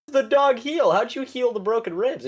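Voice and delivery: high astonished question; amazed boss. Retold or imitated. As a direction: squeak out the shock. Boss amazed dog healed.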